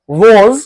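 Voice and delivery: loud "was" with rounded lips, like "woz"; model pronunciation. Shown as a demonstration. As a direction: round the mouth and project "was" for repeating. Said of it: "आप मेरे साथ pronounce करना".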